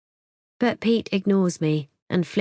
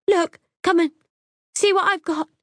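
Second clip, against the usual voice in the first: very high, urgent cry; high frantic plea; desperate woman shouting; 13 semitones higher, 5 dB louder than usual. Acted. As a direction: shout it through the window, desperate.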